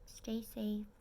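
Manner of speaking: ordinary talk, nothing marked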